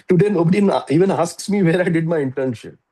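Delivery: loud, high, gleeful mock boast; amused mock brag. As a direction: crow it like a proud father teasing his crying daughter. Father's mocking inner thought, laughing.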